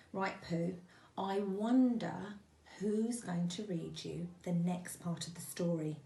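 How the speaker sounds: ordinary delivery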